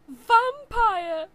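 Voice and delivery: loud high exclaim; dramatic reveal. Acted. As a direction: shout the reveal. Reading shocking tattoo reveal.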